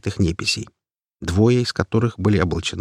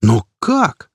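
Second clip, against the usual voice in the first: surprised blurt; 5 semitones higher, 5 dB louder than usual. A narrator's reading. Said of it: "вырвалось у меня".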